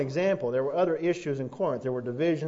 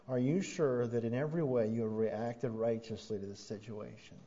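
soft, lower, gentle question; gentle kind question; 5 semitones lower, 6 dB quieter than usual. Shown as a demonstration. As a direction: ask it kindly and softly, without accusing. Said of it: "to just gently and kindly ask them".

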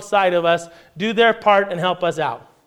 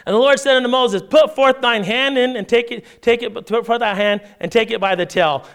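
measured, raised reading of the passage; scripture reading; 5 semitones higher than usual. Acted. Pastor reads Exodus aloud to the church, measured reading voice. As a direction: read the King James text to the congregation.

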